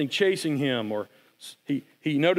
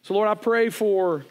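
closing prayer. Real, not acted. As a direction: pray it gently over the congregation.

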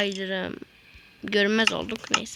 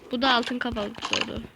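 normal talking voice

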